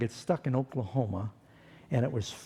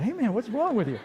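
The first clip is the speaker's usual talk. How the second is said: high, laughing disbelief; 8 semitones higher than usual. Retold or imitated. To friend caught up in the chanting, high amused laughing. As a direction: laugh at your friend as you ask. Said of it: "I said".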